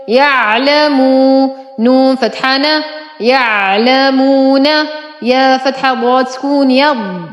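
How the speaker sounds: ordinary talk, nothing marked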